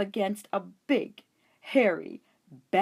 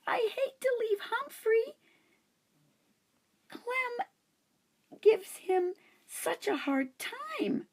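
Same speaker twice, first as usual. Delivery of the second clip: protesting. A narrator's reading. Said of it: "Miranda protested".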